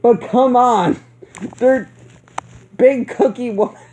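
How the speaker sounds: laughing. Real, not acted. Still laughing at scene.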